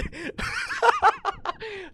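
high squealing burst of laughter; big real laugh. Real, not acted. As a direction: crack up helplessly at the chat. Viewers roasting him on stream.

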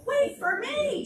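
high, slow piglet squeal. Acted. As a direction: drag out the plea to wait. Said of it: "squealed Little Pink Pig".

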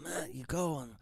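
a hint of a soft mob-boss rasp; lightly voiced Godfather advice; mock Godfather voice. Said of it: "he was talking like that".

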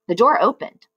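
ordinary delivery, nothing marked

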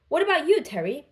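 plain reading voice

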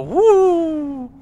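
high hooting ghost wail; spooky ghost woo. Pretend. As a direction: hoot like a cartoon ghost.

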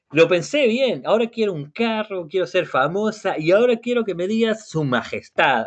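high-pitched, eager list of demands; demanding. Acted. Eliana piles wishes on the genie, demanding.

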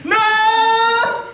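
long, very high "no" wail; anguished cry. Acted. Man mourns his left hand, wailing "no". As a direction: hold a melodramatic high scream of grief.

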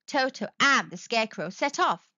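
usual speaking voice

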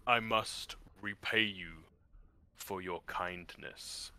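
low, slow crab creature rumble; deep rumbling voice. Acted. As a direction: rumble it out like an ancient beast. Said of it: "you hear a deep rumbling voice".

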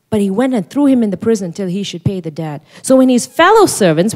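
ordinary delivery

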